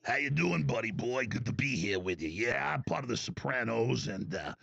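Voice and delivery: put-on low mobster-style New York accent. Host parodying himself in New York, low mobster accent. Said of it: "I go to New York, I'm like".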